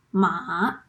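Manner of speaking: "ma." dipped low, then rising; "ma." in a low falling-rising Mandarin third tone; dipping third tone. Shown as a demonstration. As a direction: let "ma." dip down and climb back up. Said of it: "The third tone is a tricky one".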